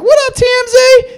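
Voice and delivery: very high, slow put-on diva voice. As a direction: toss it back sweetly, way up high. Imitating [name] greeting him back.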